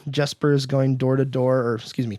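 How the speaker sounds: ordinary talk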